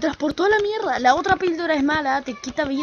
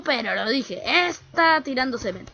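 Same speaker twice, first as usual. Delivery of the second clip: normal talking voice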